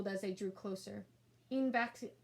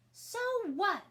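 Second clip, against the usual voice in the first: put-on high, louder defiant child voice; defiant kid; 11 semitones higher, 7 dB louder than usual. A narrator's reading. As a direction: toss it back with a sneer. Sister defies brother.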